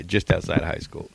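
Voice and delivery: ordinary talk, nothing marked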